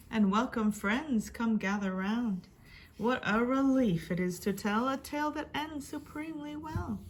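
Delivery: usual speaking voice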